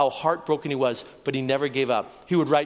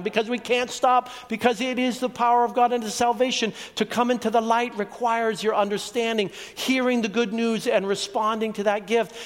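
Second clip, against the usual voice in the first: higher, urgent sermon voice; fervent preaching; 6 semitones higher than usual. Acted. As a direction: press the message home to the listeners. Preaching gospel at full force.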